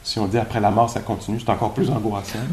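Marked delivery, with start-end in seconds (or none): none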